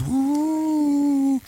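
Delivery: high, loud ghostly woo; mock ghost wail; spooky woo. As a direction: hoot it like a ghost in the graveyard. Spooky graveyard at night.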